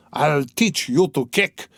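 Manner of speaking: slow, menacing snarl of rage; ferocious threat. A narrator's reading. Mikolka threatening the kicking mare, slow ferocious threat. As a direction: spit each word at the mare. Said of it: "Mikoka shouted ferociously".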